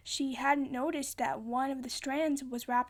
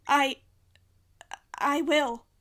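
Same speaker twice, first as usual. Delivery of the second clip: loud, hesitant promise; shaken loud answer; 2 semitones higher, 8 dB louder, slower than usual. A narrator's reading.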